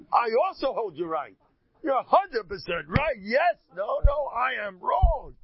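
loud, high, over-the-top surrender; screamed concession. Shown as a demonstration. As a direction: shout your agreement at the top of your voice. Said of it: "I scream".

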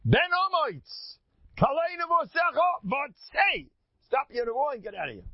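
high, loud, sharp order; Chizkiah's commanding rebuke. Retold or imitated. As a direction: throw the prophet out with a ringing shout. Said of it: "That's the way he talks".

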